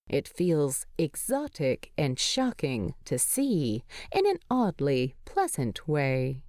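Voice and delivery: usual speaking voice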